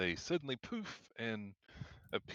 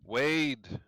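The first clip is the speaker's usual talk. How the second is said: hurried; 4 semitones higher, 5 dB louder than usual. Acted. Panicked NPC answering hastily.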